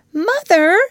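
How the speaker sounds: high, loud call to mother; Wendy's cry. Acted. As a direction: call out to reach her. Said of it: "Wendy cried".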